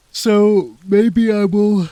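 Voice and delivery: words stretched through a big yawn. Real, not acted. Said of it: "that yawn just did it for me".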